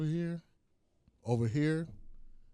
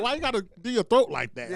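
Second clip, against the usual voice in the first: mock British; 8 semitones higher than usual. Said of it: "I'm like".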